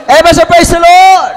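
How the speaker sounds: loud, high rallying cry; rally the church with a shout; shouted praise. Real, not acted. Preacher shouts praise to his congregation, loud and high.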